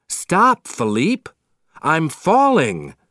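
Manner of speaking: loud, high cry of alarm; shouting. A narrator's reading. Maurice to his horse as he falls, shouting. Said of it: "Maurice shouts".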